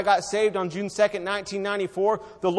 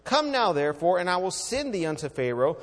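ordinary talk, nothing marked

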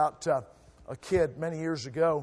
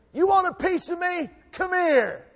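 much higher, cocky schoolkid dare; cocky taunt; 7 dB louder than usual. Retold or imitated. Kid daring the bully with his brother behind him, cocky and high. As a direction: goad the bully to come on.

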